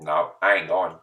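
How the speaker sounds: ordinary delivery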